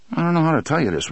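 lightly voiced Eddie, quick. A narrator's reading.

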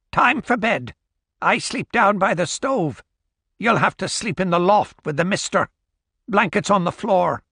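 put-on high curt old crone; old woman voice.

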